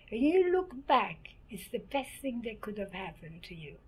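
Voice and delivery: ordinary delivery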